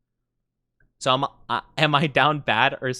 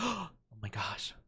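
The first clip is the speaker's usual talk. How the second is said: normal talking voice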